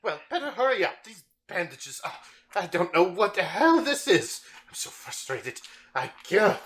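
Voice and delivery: high, strained voice of a sick, bitter man; sick, irritable Gilles. Acted. Sick patient complaining to the caravan merchant, high and irritable. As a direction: snap at the merchant through the pain.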